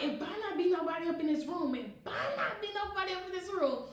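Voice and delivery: warning mom. Retold or imitated. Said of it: "She was like".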